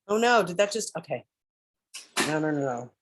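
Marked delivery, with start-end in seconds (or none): none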